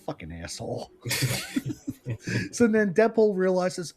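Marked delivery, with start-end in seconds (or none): 0.1-0.9 much lower, much softer curse under the breath; a quiet bitter aside; muttered curse. Retold or imitated. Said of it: "Deadpool's like". 1.0-2.5 a breathy laugh jumping high; real high-pitched giggle. Real, not acted. Laughs after quote.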